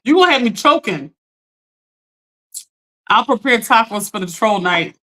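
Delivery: ordinary talk, nothing marked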